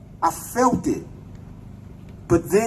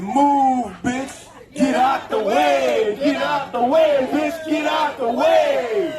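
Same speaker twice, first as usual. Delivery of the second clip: retold yell; 5 semitones higher, slower than usual. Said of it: "She said".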